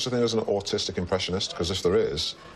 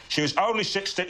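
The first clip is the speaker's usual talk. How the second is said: high-pitched emotional Cockney outburst; Michael Caine; 12 semitones higher than usual. Shown as a demonstration. Friend topping his demonstration, high anguished Cockney. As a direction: let the emotion push the voice up high. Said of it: "gets very emotional indeed".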